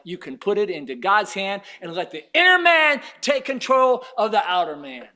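loud, driving exhortation; forceful preaching. Real, not acted. Preacher urges believers to let God take control, loud exhortation.

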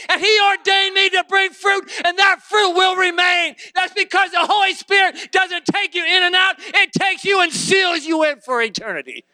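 high, loud shouted preaching, building fervor; preaching at full force. Real, not acted. Preacher getting happy before the congregation, shouting. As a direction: let it ring out like a revival shout. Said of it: "I'm gonna get happy here in a second".

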